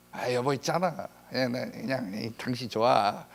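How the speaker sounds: soft, hesitant wife; wife hedges with her husband, soft and coy. Acted. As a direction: hedge sweetly, half-mumbled.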